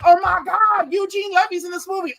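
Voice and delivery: high, loud-ish excited squeal of recognition; excited screaming kid. Retold or imitated. Young self spotting a favourite actor at home, excited. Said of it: "I started screaming".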